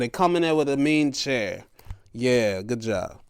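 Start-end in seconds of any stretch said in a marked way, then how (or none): none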